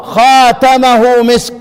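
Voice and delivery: slow خاتمه with open, lengthened kha; Kisai's reading خاتمه drawn out; reading khatamuhu. Shown as a demonstration. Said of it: "افتح الخاء ومد الخاء".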